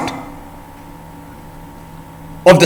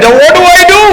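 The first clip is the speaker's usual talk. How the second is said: very high, louder worried cry; worried frog; 13 semitones higher, 4 dB louder than usual. Acted. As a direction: panic like a cornered frog.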